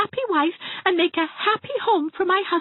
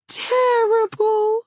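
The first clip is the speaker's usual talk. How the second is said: "terrible" stretched slow and louder, a tearful moan; drawn-out terrible; 5 dB louder, much slower than usual. Acted. Hazel moaning her grief to Anne, slow and tearful. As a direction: linger on "terrible" like a sigh of despair. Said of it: "It is terrible".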